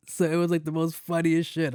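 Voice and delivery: ordinary delivery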